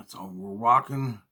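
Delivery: normal talking voice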